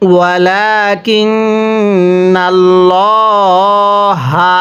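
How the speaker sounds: ওয়ালাকিন্নাল্লাহা recited very slowly, নুন held with nasal hum; nasal ghunnah. Shown as a demonstration. Teacher demonstrating ghunnah on the নুন, slow recitation. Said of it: "এই নুনের মধ্যে আমরা গুন্নাহ করব".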